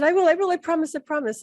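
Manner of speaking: high, insistent retold assurance; hurried promising. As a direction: give in and promise repeatedly to stop the nagging. Retells giving in to urging.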